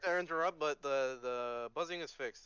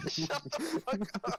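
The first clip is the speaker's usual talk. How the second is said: laughing disbelief; 9 semitones higher, much slower than usual. Real, not acted.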